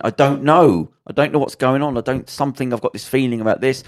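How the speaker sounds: retold bewilderment. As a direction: act out a man at a loss.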